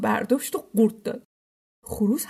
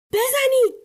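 loud, high one-word order; shouted command; 14 semitones higher, 6 dB louder than usual. A narrator's reading. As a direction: shout the order sharply upward in pitch. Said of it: "امیر دستور داد". (دستور با فریاد بلند)

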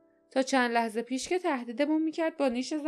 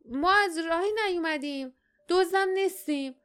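moderately raised, higher voice; Jasmine's protesting shout; 8 semitones higher, 4 dB louder than usual. Acted. Girl denying to old woman they're thieves, mild shout. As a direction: cry out in protest, not full force. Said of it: "جاسمین فریاد زد".

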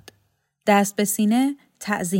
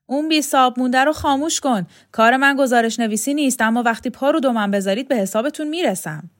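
loud, fast, higher threats; threatening angry neighbour; 5 semitones higher, 5 dB louder, faster than usual. Acted. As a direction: order them sharply, then threaten payback. Neighbour threatens, shaking his fist.

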